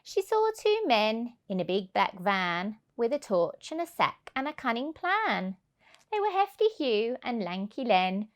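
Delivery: usual speaking voice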